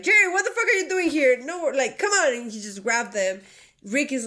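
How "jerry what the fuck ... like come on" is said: higher, louder and fast through a laugh; mock exasperated yell. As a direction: hurry Jerry along, laughing in disbelief. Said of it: "they're like".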